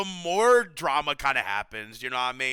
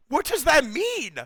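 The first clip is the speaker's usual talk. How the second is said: ordinary talk, nothing marked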